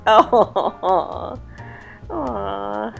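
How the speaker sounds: usual speaking voice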